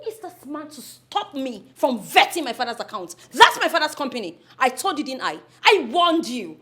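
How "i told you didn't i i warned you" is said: usual speaking voice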